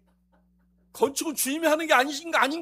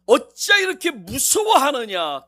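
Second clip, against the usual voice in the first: slow loud rebuke; 12 dB louder, slower than usual. Acted. Jesus rebukes again.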